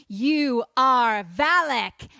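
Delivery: loud and very slow, each word landed; loud, slow verdict naming the demon. Retold or imitated. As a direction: slowly hurl the name at it. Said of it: "And Lorraine says".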